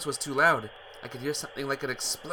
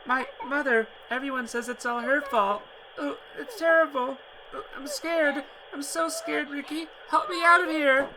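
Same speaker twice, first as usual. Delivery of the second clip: put-on high girl's voice crying and pleading; high frightened girl crying down the phone; sobbing in fear; 13 semitones higher, 4 dB louder than usual. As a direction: cry out terrified for help. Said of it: "broke into convulsive sobbing".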